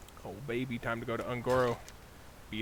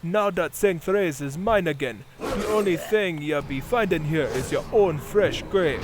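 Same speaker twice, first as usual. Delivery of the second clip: loud, menacing villain gloating; 6 semitones higher, 11 dB louder than usual. Acted. Villain quest dialogue read.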